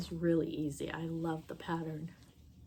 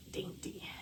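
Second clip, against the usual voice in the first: whispered; 7 dB quieter than usual. Real, not acted. Whispered word after "very, very.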